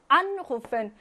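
"Anrufen" stressed on AN; first-syllable stress. Shown as a demonstration. Teacher drilling separable verbs, "Anrufen" stressed on AN. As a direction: hit AN at the start of "Anrufen". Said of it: "분리동사는 일 강세".

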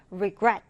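"regret" stressed on GRET; stress on second syllable. Shown as a demonstration. English teacher modelling a new word for learners, "regret" stressed on GRET. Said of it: "디딤절에 강세를 두고".

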